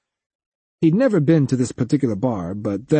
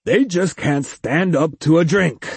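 jolly booming man; 5 semitones higher than usual. A narrator's reading. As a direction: boom it out, amused. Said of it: "boomed a jolly male voice".

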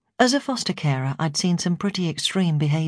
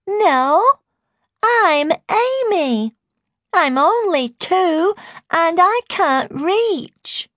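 put-on very high, slow, louder toddler voice; heightened baby voice; 14 semitones higher, 5 dB louder, much slower than usual. A narrator's reading. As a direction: insist like a baby, stretching the words. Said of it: "heightening her baby voice".

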